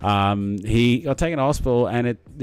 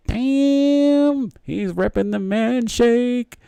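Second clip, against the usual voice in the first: much higher, slow, admiring woman's voice; impressed lady; 12 semitones higher, 3 dB louder, much slower than usual. Pretend. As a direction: play an impressed lady eyeing him up. Imagined lady checking him out.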